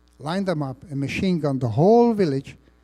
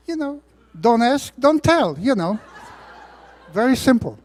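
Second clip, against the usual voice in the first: high, loud, chuckling quip; amused wink at the audience; laughing aside; 8 semitones higher, 5 dB louder than usual. Real, not acted. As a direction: toss it off with a laugh, much higher. Joking aside about hiding his German.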